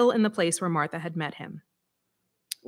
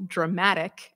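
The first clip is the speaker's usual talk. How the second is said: usual speaking voice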